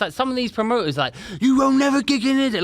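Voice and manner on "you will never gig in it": high, loud promoter's outburst; imitating an irate promoter's shout; in-your-face rant. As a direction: yell it right in their face. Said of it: "Like, really in my face".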